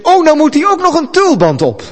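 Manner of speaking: high, loud excited outburst; eager interjection. Acted. Prophet butting into the vision, excited high interjection. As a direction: butt in loudly with a sudden idea. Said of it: "En dan bemoeit de profeet zich ermee".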